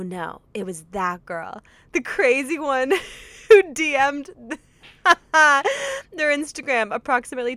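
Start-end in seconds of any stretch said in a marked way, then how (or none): 1.9-7.6 giggling through the words; laughing. Real, not acted. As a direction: laugh while you read. Laughing while reading.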